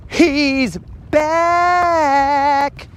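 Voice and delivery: a high cheering shout from the crowd. Retold or imitated. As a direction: yell it like a crowd celebrating a comeback. Crowd cheers his return.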